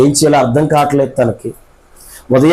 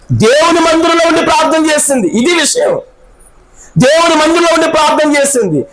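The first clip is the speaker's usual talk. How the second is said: much higher, sustained preaching cry; full-force preaching; 8 semitones higher than usual. Acted. Preacher telling Hanna's anguished prayer, high full-force preaching.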